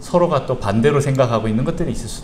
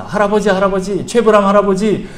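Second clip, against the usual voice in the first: put-on pleading child; 8 semitones higher, 7 dB louder than usual. Son pleads with grandpa.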